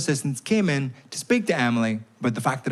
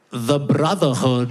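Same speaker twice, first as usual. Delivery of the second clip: solemn slow heckle; 3 dB louder, much slower than usual. Retold or imitated. Boy heckling the lesson.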